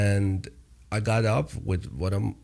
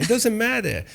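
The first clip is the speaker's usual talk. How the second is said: laughing it off; 12 semitones higher than usual. Real, not acted. Laughs mid-sentence.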